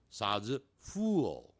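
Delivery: ordinary talk, nothing marked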